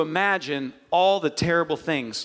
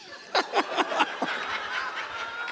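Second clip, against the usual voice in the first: high, breathless burst of laughter; high breathy laugh; 4 dB quieter than usual. Real, not acted. Laughing at the doctor's age remark.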